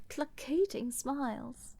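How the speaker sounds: ordinary talk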